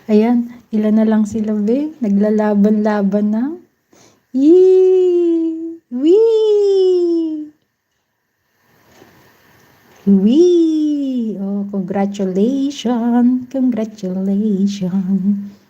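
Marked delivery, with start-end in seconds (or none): 4.3-11.4 high gleeful squeals; excited squeal. Real, not acted. Host cheering a game winner.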